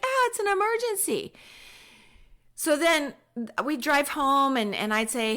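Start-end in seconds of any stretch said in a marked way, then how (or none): 0.0-1.3 high hammed-up alarm; mock alarm. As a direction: cry it out like a drama-queen mom.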